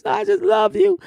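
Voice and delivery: high sweet gush; gushing singer. Retold or imitated. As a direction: coo it like a starstruck girl. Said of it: "this little girl".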